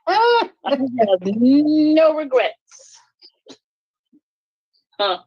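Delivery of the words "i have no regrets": high, very slow, savoring each word; slow and deliberate. Real, not acted. Telling the group she's done, slow and high. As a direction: draw it out high and slow, relishing it. Declaring freedom from marriage.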